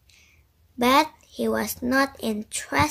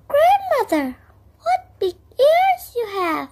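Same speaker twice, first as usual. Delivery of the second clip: put-on much higher innocent girl; little girl voice; 14 semitones higher, 4 dB louder than usual. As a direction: ask wide-eyed like a small girl. Girl speaking to disguised wolf.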